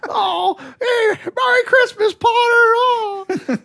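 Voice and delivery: high, loud festive cry; mock giddy greeting. Parodying a joyful Christmas run through town, high giddy shout. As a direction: holler it in hammed-up ecstasy.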